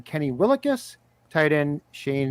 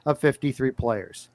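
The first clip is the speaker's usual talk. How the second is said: mock news anchor, louder and punchy; breaking news read; 2 semitones higher, 4 dB louder than usual. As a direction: announce it like a breaking news bulletin. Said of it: "fire up the breaking news sounder".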